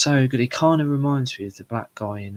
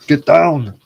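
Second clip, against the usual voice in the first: loud, raised action-hero shout; Arnold; 6 semitones higher, 13 dB louder than usual. Retold or imitated. Impressionist switching chairs as a film star, loud imitated shout. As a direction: bellow a mock order in a put-on voice. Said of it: "like, Arnold".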